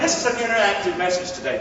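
usual speaking voice

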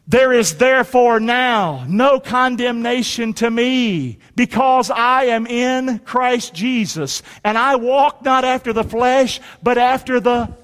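slow, measured declaration in short phrases; congregational scripture recitation. Real, not acted. Preacher leads congregation reciting a verse, slow declaration. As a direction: lead a crowd reciting, phrase by phrase.

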